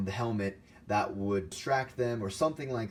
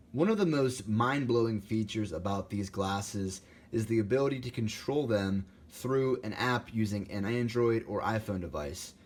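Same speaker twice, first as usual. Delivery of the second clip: ordinary delivery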